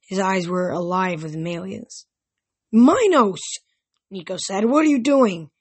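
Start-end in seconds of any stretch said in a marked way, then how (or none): 2.7-3.4 high, loud call of a name; startled raised call; shocked shout. A narrator's reading. Nico calling out the ghost. 4.6-5.5 higher, louder demanding question; raised alarmed question. A narrator's reading. Nico confronting the ghost.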